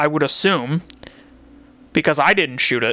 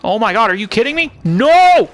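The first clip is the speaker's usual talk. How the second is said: raging gamer; 6 semitones higher, 6 dB louder than usual. Real, not acted. Game mishap, gamer explodes.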